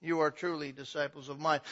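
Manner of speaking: loud, high, driving sermon climax; full-force preaching. Acted. Preacher pressing the church, loud and rising.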